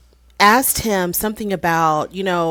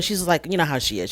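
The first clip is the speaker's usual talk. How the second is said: ordinary talk, nothing marked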